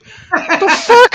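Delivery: high, sharp mock startle; startled. Hearing the ghost whisper, high startled mock yelp. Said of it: "And I'm like".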